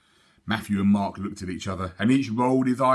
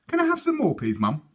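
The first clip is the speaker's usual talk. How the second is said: higher, sweetly asking; lightly voiced girl begging for more; asking little girl; 12 semitones higher than usual. Sophie asking mum for more milk, sweetly.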